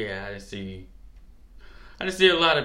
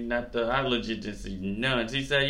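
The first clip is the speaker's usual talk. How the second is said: ordinary talk, nothing marked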